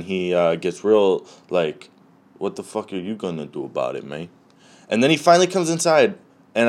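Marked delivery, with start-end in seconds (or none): 2.4-4.3 soft, quick, lightly voiced dog; defiant dog. Dog talking back to its owner, lightly voiced defiance. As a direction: toss it off quickly under your voice as a cocky dog.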